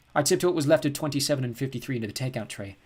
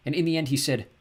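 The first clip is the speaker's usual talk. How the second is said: ordinary delivery, nothing marked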